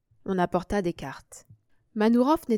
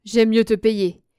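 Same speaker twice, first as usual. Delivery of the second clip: loud, slow amused refusal; 3 semitones higher, 9 dB louder, slower than usual. A narrator's reading. Serge preferring to pay up, loudly amused. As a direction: decline lightly, drawing it out louder. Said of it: "dit Serge en riant".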